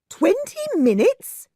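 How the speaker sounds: high, loud frightened cry. A narrator's reading. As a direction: shriek it out in fright. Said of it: "cried Missus Thornton".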